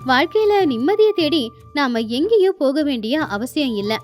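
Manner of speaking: ordinary delivery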